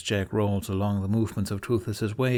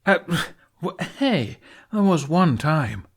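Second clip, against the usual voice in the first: higher, flustered protest; raised, indignant sputter; 8 semitones higher, 5 dB louder than usual. Acted. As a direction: trip over the words defending himself. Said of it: "Jack sputters".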